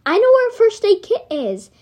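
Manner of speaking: high, loud eager voice; eager offer. A narrator's reading. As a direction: jump in helpfully.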